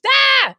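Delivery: short loud high yell; sudden yell to prove free speech; demonstrative yell. Shown as a demonstration. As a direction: let out one defiant holler. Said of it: "why can't I yell?".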